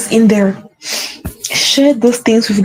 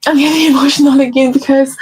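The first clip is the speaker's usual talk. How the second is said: higher, laughing through tears; teary laugh; 4 semitones higher than usual. Real, not acted. Talking to viewers about her faith, a real tearful laugh. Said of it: "and I'm getting emotional again".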